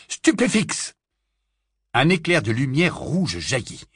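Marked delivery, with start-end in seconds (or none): 0.1-0.9 high, forceful incantation cry; cast spell. Acted. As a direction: hurl the spell out sharply. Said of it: "et lança". (sort lancé d'un cri aigu)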